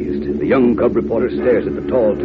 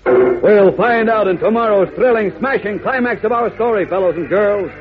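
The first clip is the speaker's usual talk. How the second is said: excited radio announcer; 13 semitones higher, slower than usual. Acted. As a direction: sell tomorrow's smashing climax. Announcer promo for next episode.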